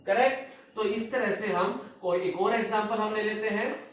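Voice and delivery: ordinary talk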